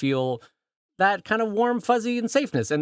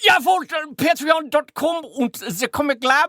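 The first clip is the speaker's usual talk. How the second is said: high, loud mock-German accent; 9 semitones higher, 4 dB louder, slower than usual. Shown as a demonstration. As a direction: do a hammed-up German accent, pitched well up.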